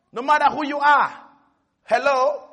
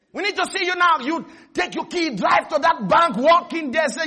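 ordinary talk, nothing marked

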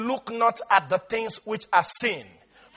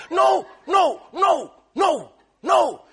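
higher, firm refusal; emphatic no; 5 semitones higher, slower than usual. Real, not acted. Preacher rejecting believer's excuse, firm. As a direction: shut the excuse down. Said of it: "preacher rejects the excuse".

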